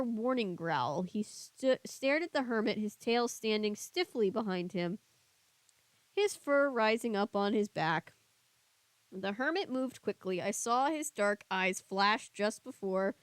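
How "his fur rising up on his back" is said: ordinary delivery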